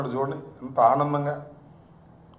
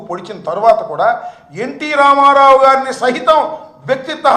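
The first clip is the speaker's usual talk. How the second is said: heated tirade; 5 semitones higher, 7 dB louder than usual. Real, not acted. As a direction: voice climbing higher and louder into an angry rant. Angry political attack on Chandrababu. (కోపంగా గట్టిగా అరుస్తూ)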